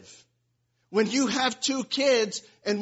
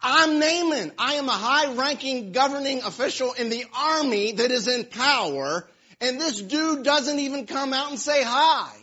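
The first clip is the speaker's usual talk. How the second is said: higher, louder, offended official fuming; indignant Naaman; 4 semitones higher, 5 dB louder than usual. Retold or imitated. Preacher voicing Naaman's wounded pride, indignant. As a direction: play the insulted big shot, huffing. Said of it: "He was beyond furious".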